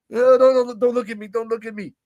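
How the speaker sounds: imitated crying, high-pitched; Inamura. Crying man begs Briggs not to look, high mock-tearful plea. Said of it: "you feel like he's crying".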